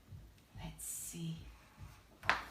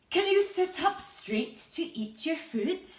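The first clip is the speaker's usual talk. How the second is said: higher, louder nagging parent; 8 semitones higher, 7 dB louder than usual. Acted. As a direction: ask like a fed-up mum. Parent voiced asking child.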